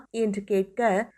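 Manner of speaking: ordinary delivery, nothing marked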